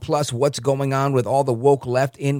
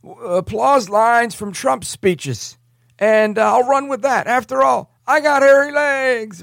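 mock Biden voice; 9 semitones higher than usual. Mocking Biden's imagined order.

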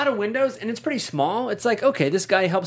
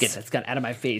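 mock villain line; 7 semitones lower, 4 dB quieter than usual. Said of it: "echoes clip".

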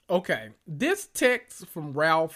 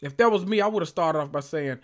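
usual speaking voice

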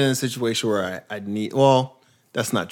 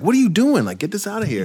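high-pitched scolding; mock indignation; 9 semitones higher, faster than usual. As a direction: act out telling him off, voice jumping up. Acting out confronting the meth owner.

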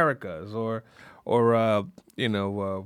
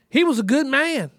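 put-on high, emphatic old-lady voice; high grandmother impersonation; elderly grandmother; 8 semitones higher, 4 dB louder than usual. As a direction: imitate a ninety-something grandma, pitched up. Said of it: "this is a ninety-three-year-old woman".

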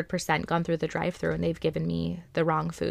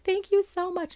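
gushing thanks; 12 semitones higher than usual. Retold or imitated. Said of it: "And I was like".

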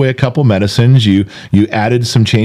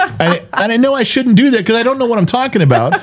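laughing; 11 semitones higher than usual. Real, not acted. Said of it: "laughs while talking".

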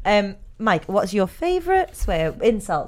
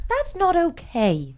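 mock prim; 7 semitones higher, much slower than usual. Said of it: "now you're like".

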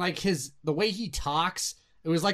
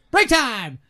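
high, loud announcement; calling out; 7 semitones higher, 8 dB louder than usual. Real, not acted. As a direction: sing out the break to everyone. Calls out the break.

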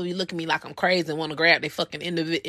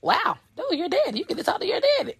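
excited for daughter; 10 semitones higher, faster than usual. Retold or imitated. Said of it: "I said".

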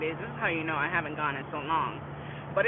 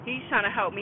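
ordinary talk, nothing marked

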